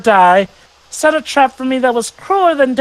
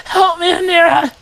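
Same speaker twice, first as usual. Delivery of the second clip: high, sobbing cries for help; anguished crying scream; 8 semitones higher than usual. Acted. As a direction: beg through tears, screaming. Screaming after losing his love.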